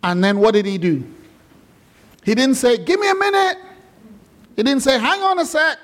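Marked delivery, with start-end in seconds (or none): 2.8-3.6 very high comic voice; comic stalling reply. Acted. Preacher voicing what Lazarus didn't say, high comic stalling. 5.0-5.8 high comic put-on voice; comic stalling reply. Preacher voicing what Lazarus didn't say, high comic stalling.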